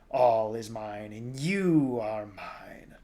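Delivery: slow, loud monster claim; buzzing, snarling creature. A narrator's reading. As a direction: stretch it out, building in menace. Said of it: "mixture of buzz and snarl".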